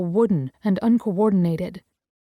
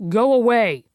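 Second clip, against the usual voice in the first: a louder yelled dismissal; 5 dB louder than usual. Acted. Gray yelling at the man outside the door, loud. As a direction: bark it at the door. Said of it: "just as Gray yells".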